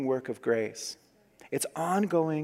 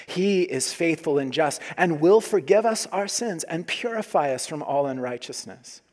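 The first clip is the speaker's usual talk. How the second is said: normal talking voice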